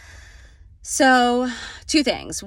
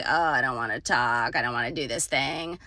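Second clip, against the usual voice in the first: low, sulky reluctant voice; reluctant grumble; 6 semitones lower, 4 dB quieter than usual. Retold or imitated. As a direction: whine like you dread the work. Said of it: "or be like".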